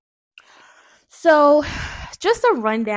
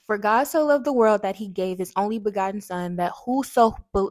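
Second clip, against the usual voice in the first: reading the verse aloud, measured; scripture reading; 5 dB louder than usual. Acted. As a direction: recite it like scripture. Reads a Bible verse.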